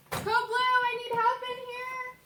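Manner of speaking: loud, high, urgent shout; shouting for help. Acted. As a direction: call the code across the ward. Nurse calls code, patient crashing.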